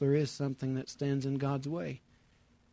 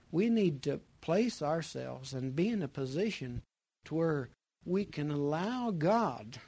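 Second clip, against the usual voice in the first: ordinary talk, nothing marked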